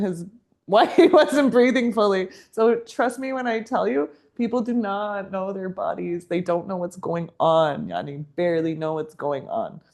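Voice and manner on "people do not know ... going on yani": normal talking voice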